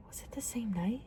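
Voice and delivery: hushed, voiceless wondering; real whispered aside; whispered to self. Real, not acted. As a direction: whisper it as if checking your memory. Wondering aloud to herself.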